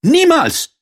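loud, very high princess exclamation; crying out. A narrator's reading. Princess refuses the frog outright, high loud cry. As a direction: call out a high, emphatic refusal. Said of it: "rief die Prinzessin".

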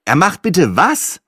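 high, slow, disbelieving question; incredulous call. A narrator's reading. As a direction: call it up in disbelief, stretching the words. Caller disbelieving, calling up to phone. (ungläubig nach oben gerufen)

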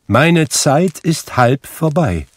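high, loud, slow warning; admonishing. A narrator's reading. Ghost with little time left, urgently admonishing. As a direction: warn him with drawn-out urgency. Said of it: "mahnte der Geist".

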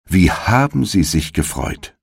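ordinary delivery, nothing marked

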